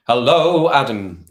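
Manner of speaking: high, loud hello; excited greeting. Real, not acted. Streamer spots Adam in the chat, excited hello. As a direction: sing out a big welcome. Greeting newly arrived viewer.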